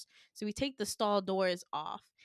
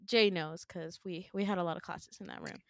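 ordinary delivery

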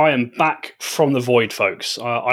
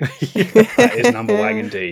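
slow, higher, creepy announcer; eerie calm announcer; 8 semitones higher than usual. Pretend. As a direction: intone it like a sinister bulletin. GM joins eerie broadcast bit.

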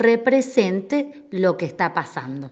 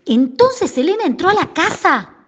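high and loud, full of excitement; thrilled storyteller; excited telling; 10 semitones higher, 4 dB louder than usual. Shown as a demonstration. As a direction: announce it like big news. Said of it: "Te doy un ejemplo".

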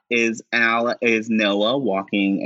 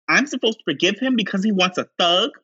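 high, fast, indignant; indignant Chance; 11 semitones higher, faster than usual. Retold or imitated. Chance complaining to Ricky about the cheating, high and fast. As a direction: rattle off the outrage.

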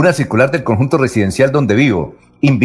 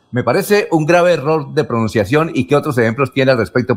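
ordinary talk, nothing marked

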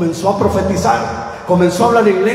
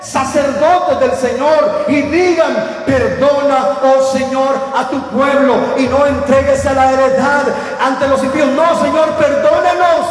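raised, pleading preacher's cry; fervent preaching; 5 semitones higher, slower than usual. Acted. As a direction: cry out to God for mercy from the pulpit. Preacher crying out to God. (predicación ferviente y suplicante)

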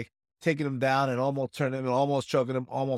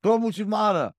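coach calling; 6 semitones higher, 4 dB louder than usual. Retold or imitated. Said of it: "He was probably saying something like".

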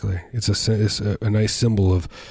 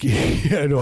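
snappy; 5 semitones higher than usual. Retold or imitated. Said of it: "You s-, snapped at her".

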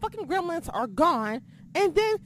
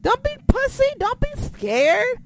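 higher, louder taunting challenge; defiant challenge; 9 semitones higher, 5 dB louder than usual. Real, not acted. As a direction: taunt the critic to show themselves. Challenging the anonymous critic.

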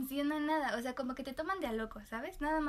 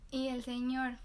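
ordinary delivery

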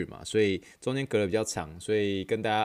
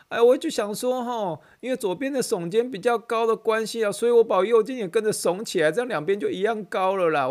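imitating the aunt; 12 semitones higher, 5 dB louder than usual. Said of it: "美国白人这样".

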